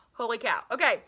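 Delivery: ordinary delivery, nothing marked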